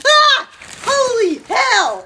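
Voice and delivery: high, loud shriek of fright; startled scream. Real, not acted. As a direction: jump and scream at the spider. Sudden spider fright.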